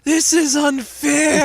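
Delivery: high, slow wail through laughter; hammed-up crying complaint; mock whine. Reenacting the recorded whine.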